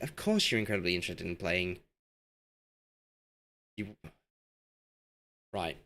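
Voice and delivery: usual speaking voice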